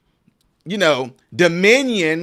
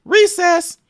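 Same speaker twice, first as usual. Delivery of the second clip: put-on child's call; 10 semitones higher than usual. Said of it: "They say, "It's time for".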